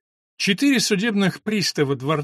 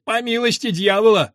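high, louder cheeky reply; schoolboy's retort; 6 semitones higher, 5 dB louder than usual. A narrator's reading. Joannes answers his friend across the hall, calling back high. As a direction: call the answer back in a higher voice.

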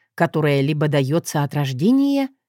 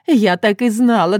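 louder, higher, bursting with joy; overjoyed; 4 semitones higher, 5 dB louder than usual. Acted. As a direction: delight spilling over now. Customer overjoyed, second take. (в полном восторге)

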